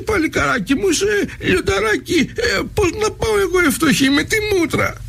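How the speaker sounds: half-crying granny pleading; wailing old woman. Acted. Mother wails to her lion son, high and tearful.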